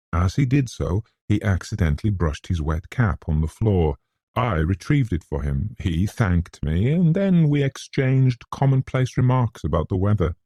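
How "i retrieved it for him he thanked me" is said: plain reading voice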